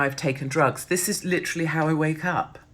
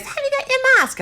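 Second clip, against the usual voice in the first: much higher, squeaky nagging shop-worker voice; mocking counter woman; 5 dB louder than usual. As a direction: mimic the fussy counter woman demanding a mask. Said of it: "So she goes".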